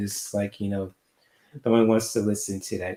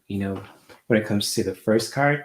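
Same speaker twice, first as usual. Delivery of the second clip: ordinary talk, nothing marked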